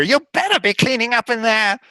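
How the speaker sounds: high, scolding hag; threatening hag voice. Acted. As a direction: warn like a nagging hag. GM voicing the hag.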